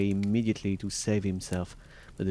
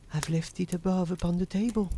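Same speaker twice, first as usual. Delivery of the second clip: high voice of a worried wife; anxious wife; 10 semitones higher than usual. A narrator's reading. Wife making excuses to Bluebeard about the key, anxious high voice. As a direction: answer as a wife hiding something, pitched high.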